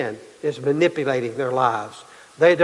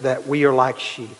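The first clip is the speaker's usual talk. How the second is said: usual speaking voice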